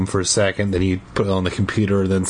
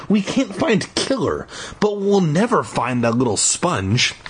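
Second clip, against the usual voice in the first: put-on 1 upset kid voice; 7 semitones higher than usual. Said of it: "I had never seen Daniel this upset".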